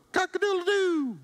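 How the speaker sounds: high crowing rooster imitation; hammed-up cock-a-doodle-doo. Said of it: "that's the best I got for you".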